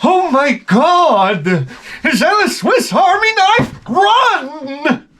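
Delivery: shrieking high and slow, half laughing; panicked snobs. Retold or imitated. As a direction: mock-scream like posh guests fleeing in terror. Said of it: "they're like".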